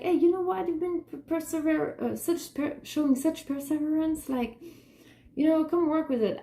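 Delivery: high, syrupy mock kindness; sing-song sarcastic imitation of a pitying person; mock sweet pity voice. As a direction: parody a condescending offer, pitched high. Mocking people who offer pity.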